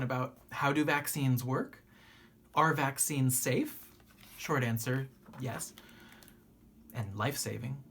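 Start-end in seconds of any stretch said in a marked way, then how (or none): none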